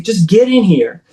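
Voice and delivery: half-voiced yell at the dogs; retold yelling. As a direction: act out calling the dogs in. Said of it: "so I'm yelling at them".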